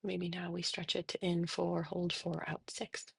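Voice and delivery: soft, unhurried meditation voice with long pauses; guided meditation. Real, not acted. Therapist leading listeners through breathing and body noticing, soft meditation. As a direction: guide them gently into settling, softly.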